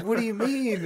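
high, loud mock bewilderment; dramatic. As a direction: imitate a friend overreacting in confusion. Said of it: "acting dramatic".

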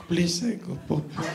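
put-on hushed, pitiful old man; small, soft grandpa voice; feeble grandpa plea. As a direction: beg softly for the toilet. Grandpa pleads in retold scene.

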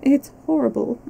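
wail of anguish. A narrator's reading. Wife breaking down before her husband, wailing. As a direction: let the word break into a lament. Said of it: "with a sudden wail of anguish".

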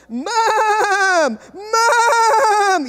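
high neighing cry of Mom; whinny the word like a horse; child whinnying like a horse; horsey mode. Retold or imitated. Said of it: "she went into horsey mode".

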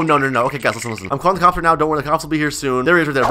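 ordinary talk

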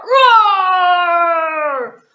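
big loud hammed-up roar; lion roar. Puppet lion waking up, loud hammed-up roar. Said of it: "the lion wakes up with a roar".

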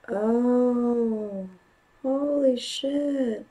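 slow, high gasp of disbelief; stunned awe. Real, not acted. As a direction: let it drop out of you, amazed. Awed reaction to a scene.

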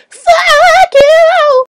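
hammed-up outburst; mock yell. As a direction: scream it in mock fury. Mock rage after stutter.

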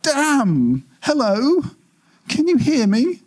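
high, urgent, slowing on stop right now; frantic machine being cut off; panicked calling out. Acted. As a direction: shout into a dead line. Hub losing connection, calling out.

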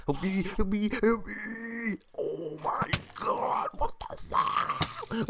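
high, squealing cries for help; drawn-out high wail while being chased; terrified pleading. Real, not acted. Chased by Slender Man, screams.

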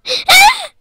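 very high, loud startled shriek; sudden scream. Real, not acted. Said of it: "sorry for screaming".

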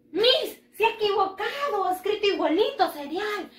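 high-pitched, quick pupil correcting the teacher; protesting pupil. Acted. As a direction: pipe up like a pupil catching a mistake.

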